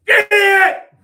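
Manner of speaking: abrupt, very high and loud outburst; explosive drill-sergeant shriek; drill sergeant scream. Retold or imitated. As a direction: scream it out of nowhere at full force. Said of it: "He would just scream like Tourette's".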